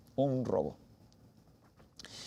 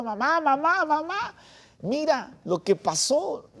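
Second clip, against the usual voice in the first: much higher, calling out "Mamá, mamá" with joy; excited calling; 12 semitones higher, slower than usual. Retold or imitated. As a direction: burst in shouting for mom with the news. Running home to tell mother.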